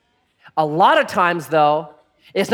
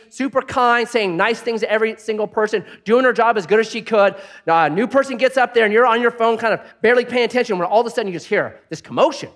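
ordinary talk

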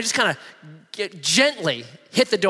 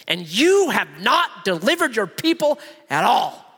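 higher, pleading accusation; Moses complaining; 7 semitones higher than usual. Acted. Moses accusing Yahweh of abandoning the people, heated high complaint. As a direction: read it like Moses scolding God.

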